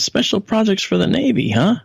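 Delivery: lightly voiced surgeon's sly query; curious doctor. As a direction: raise it up like an intrigued doctor. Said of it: "and he says".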